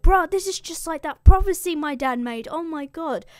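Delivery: high, loud burst of disbelief; mock dismay. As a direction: exclaim in hammed-up alarm. Alcinous acts shocked at the sight.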